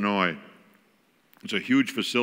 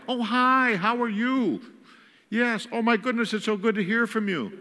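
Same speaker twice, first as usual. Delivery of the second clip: much higher, bright gushing phone greeting; mimicking mom on phone; 12 semitones higher than usual. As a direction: gush over an old friend on the phone. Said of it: "trying to mimic".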